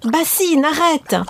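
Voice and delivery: high, rising panic at the pot; panicked cry. A narrator's reading. Mother panics.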